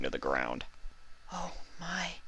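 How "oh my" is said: high, very soft shocked murmur; a shaken pony barely saying it; quietly. A narrator's reading. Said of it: "Fluttershy said quietly".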